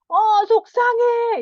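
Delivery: put-on high, loud, slow whiny child. Shown as a demonstration. Small child telling mother he is upset, whiny. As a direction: whine it like a small upset kid. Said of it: "이렇게".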